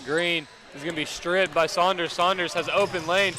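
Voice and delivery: play-by-play climbing to a loud "oh"; live play-by-play. Real, not acted. Commentator calls a drive and strip, excitement building. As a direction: build the call as the lane opens.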